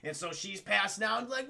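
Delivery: ordinary delivery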